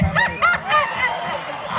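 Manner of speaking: high, put-on giggle; high mocking cackle. Recruit mocking the trainees, high cackle.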